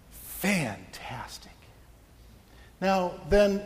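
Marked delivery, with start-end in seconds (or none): 0.1-1.5 dry whispered aside; sarcastic whisper. Pretend. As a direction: breathe it out, deadpan and sarcastic. Preacher's dry aside on pregnancy.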